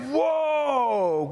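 high, long falling whoa; drawn-out awe at a prize; amazed contestant. Retold or imitated. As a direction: imitate a wowed game-show winner. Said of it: "And you're like".

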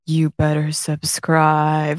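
slow, low and soft mock threat; mock menacing. Podcast host mocks forcing viewers to subscribe, slow mock-menacing. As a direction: drag it out like a creepy demand. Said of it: "That would be just, like, scary".

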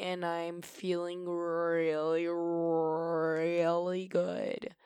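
words stretched out very slowly; savouring drawl. Real, not acted. Host telling listeners how good she feels, drawn-out savouring. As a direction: linger long on each really.

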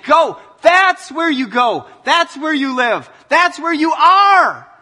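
loud, high call to go out; ringing shout. Acted. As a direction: send them out with full voice. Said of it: "it's saying".

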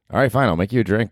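ordinary talk, nothing marked

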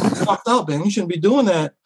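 high mock outrage at a kung fu dude; mock scolding onlooker. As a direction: play the scandalized crowd calling it out. Retelling people's scolding reaction.

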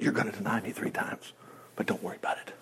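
very soft, low voice; hushed, as if confiding in a friend; gentle reassurance. Acted. The Lord forewarns Peter that same night, softly reassuring.

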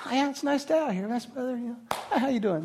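mock unbothered stroll through the crowd; breezy casual Jesus.

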